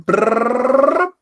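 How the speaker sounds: loud rising trill; playful trilled brrr. Pretend. Speaker rounds off his dinner list, playful rising trill. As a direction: roll out a high, loud brrr.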